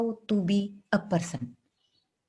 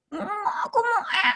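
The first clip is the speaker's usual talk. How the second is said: put-on much higher, strained, crying child's demand; straining child; 12 semitones higher than usual. Therapist imitating the girl straining and crying for the toilet, much higher. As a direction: push it out like a child straining and wailing. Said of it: "dia berusaha".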